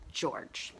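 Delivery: soft whispered name; whispering. Retold or imitated. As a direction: whisper his name gently. Gently calling crying child.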